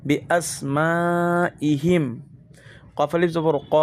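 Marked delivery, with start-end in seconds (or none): 0.1-2.1 Asma with a long held "maa"; four-beat madd stretch. Shown as a demonstration. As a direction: draw out the last vowel of Asma, then a short ইহিম. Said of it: "মধ্যে মুত্তাসিল চার আলিফ".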